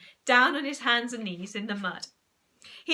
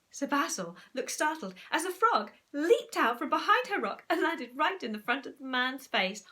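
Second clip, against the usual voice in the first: ordinary delivery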